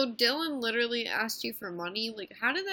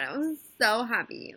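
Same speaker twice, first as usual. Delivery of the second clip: ordinary delivery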